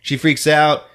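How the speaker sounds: usual speaking voice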